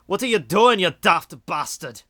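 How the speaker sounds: put-on high, loud drunken Scot; drunken Scottish accent. Shown as a demonstration. Streamer attempts a Scottish one on request, drunken Scottish accent. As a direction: scold someone in a rowdy, high-pitched Scottish accent. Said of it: "kinda like a Scottish one".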